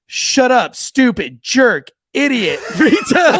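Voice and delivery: loud, high, slow list of insults; son's loud outburst. Retold or imitated. Oldest son answering the church teacher, loud blurted insults. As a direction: belt out each insult one by one. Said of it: "My oldest son goes".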